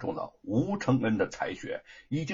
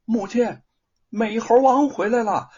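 higher, louder, calling out with joy; loud and excited; 8 semitones higher, 7 dB louder than usual. A narrator's reading. Wife calling out to her mother-in-law, loud and thrilled. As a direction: shout the news across the house. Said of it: "大声的告诉婆婆".

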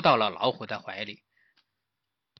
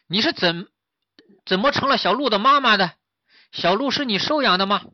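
higher, lightly voiced piglet; puzzled piglet; 8 semitones higher, 5 dB louder than usual. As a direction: question the tiger in bewilderment. (小猪疑惑地追问)

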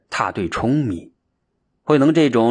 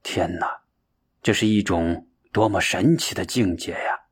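inward amazement; 5 semitones lower, 5 dB quieter than usual. A narrator's reading. Shenxiu privately marvels at Huineng's meditation, quietly amazed. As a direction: marvel softly, as if to yourself. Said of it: "神秀心里惊叹".

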